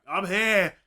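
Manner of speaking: loud, high heroic call; mock battlefield entrance yell; triumphant arrival shout. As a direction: burst in announcing yourself. Acted Jorah arriving mid-battle.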